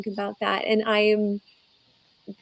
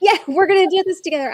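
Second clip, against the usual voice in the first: higher, louder, quick burst of eagerness; excited; 10 semitones higher, 7 dB louder, faster than usual. Retold or imitated. Donor reliving hugging the family she met, excited. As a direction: burst out with shared enthusiasm. Retelling joyful first meeting with family.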